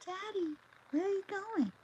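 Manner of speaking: put-on high, small child's voice; little boy. Young Spencer sees his father taken away: high little boy voice.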